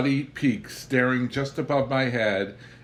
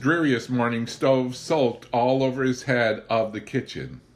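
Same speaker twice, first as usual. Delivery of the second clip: plain reading voice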